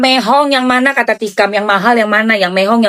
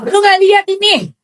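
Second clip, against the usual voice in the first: laughing high exclaim; 10 semitones higher than usual. Acted. Mock ranting at Erdik.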